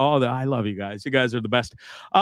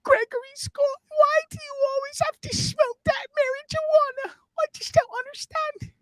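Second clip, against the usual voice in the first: shrill falsetto mom, much higher. Acted. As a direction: squeal it out like a fretting mother. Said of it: "Sorry, Mom".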